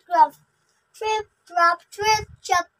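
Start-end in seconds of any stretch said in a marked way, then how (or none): none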